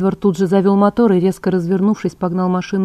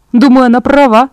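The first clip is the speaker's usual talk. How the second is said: louder, higher, emphatic backing; insistent; 5 semitones higher, 9 dB louder than usual. A narrator's reading. Emmett backs Bella against Edward, insistent. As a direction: press the point firmly. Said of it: "настойчиво проговорил Эммет".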